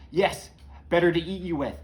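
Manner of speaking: menacing reveal at full voice; wolf unmasked. Acted. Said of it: "the wolf rips off her nightcap".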